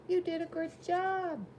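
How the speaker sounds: put-on high childlike call; children calling. Children greet the fire engine, high childlike call. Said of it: "they call".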